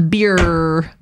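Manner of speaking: "Beer" drawn out with a sounded R; American accent. Shown as a demonstration. Contrasts American and Australian R.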